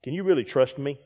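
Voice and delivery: quiet and sincere. Retold or imitated. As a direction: ask it softly and honestly. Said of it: "look at the person beside you sometime and say".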